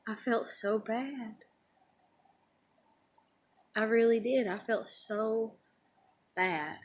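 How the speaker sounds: soft, slow and regretful; real remorse. Real, not acted. Teller remembering how she hurt boy, quietly remorseful. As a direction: let it sink quietly, close to tears. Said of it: "I could cry thinking about it".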